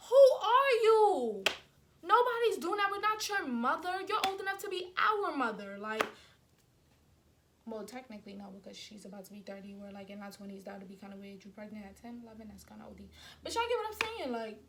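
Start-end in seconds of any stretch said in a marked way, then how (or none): 0.1-5.8 outraged yell. Real, not acted. Venting to viewers about the roommate, outraged yell. 7.7-13.1 quiet aside. Real, not acted. Quiet aside about her age.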